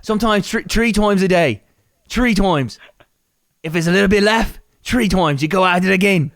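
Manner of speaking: high, loud, thick fake Irish brogue; [name]'s mock accent. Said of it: "I don't know what accent I'm doing".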